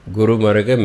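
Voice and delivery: usual speaking voice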